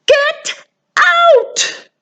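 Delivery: high put-on voice ordering them out; character yelling.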